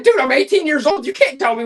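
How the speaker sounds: high, loud objection to the doctor; mock indignant protest. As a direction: protest loudly, voice jumping up. Retold protest to his doctor.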